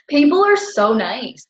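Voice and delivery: slow, laughing delivery. Real, not acted. Laughing as she tells viewers people are nice. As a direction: laugh it out warmly. Laughs after name demo.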